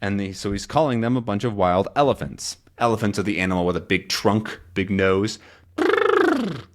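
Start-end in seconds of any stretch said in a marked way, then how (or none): 5.8-6.7 high trilled elephant imitation; badly faked elephant call; terrible elephant trumpet. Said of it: "was a terrible elephant".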